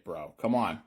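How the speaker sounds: ordinary talk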